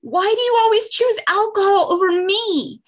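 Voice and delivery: high, hurt voice of a pleading daughter. Retold or imitated. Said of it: "your daughter, for example, saying".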